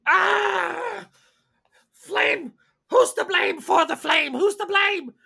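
high, loud panicked shrieking; panicked screaming. Acted. Game master as a vampire hit with a torch, screaming. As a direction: shriek it out in alarm.

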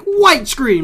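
high, loud mock alarm; startled exclamation. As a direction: blurt it out like a sudden glare. Acting out a sudden blinding screen.

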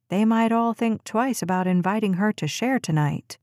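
plain reading voice

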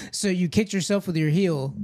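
ordinary talk, nothing marked

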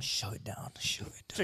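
hushed urging; whispering. Real, not acted. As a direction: whisper the push to keep swallowing. Egging friend on mid-chug.

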